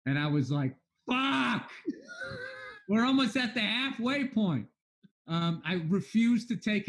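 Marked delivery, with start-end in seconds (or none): 1.1-4.7 panicked yelp, pitched high; high alarmed outburst about money; retold panic. Panicking over savings running out.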